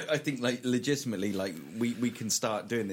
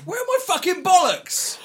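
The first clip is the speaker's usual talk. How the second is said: squeaky high, loud dog voice; 12 dB louder than usual. Pretend. Dog voice asks question.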